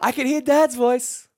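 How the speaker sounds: put-on high, loud child impersonation; excited young son. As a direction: exclaim like a boy recognizing his father. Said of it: "my son was like". (父に気づいた子どもの声まね)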